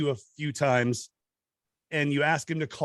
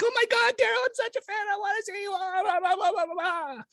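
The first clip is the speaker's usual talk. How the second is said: very high, squealing fan voice; breathless high fangirl gushing at the DJ. Retold or imitated. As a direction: squeal it like a starstruck fan girl. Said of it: "and she's like".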